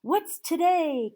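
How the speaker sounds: loud high call, slow. Acted. Scrooge calling down to a boy, loud and high. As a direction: shout down to the street. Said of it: "cried Scrooge".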